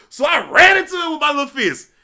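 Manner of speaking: ordinary delivery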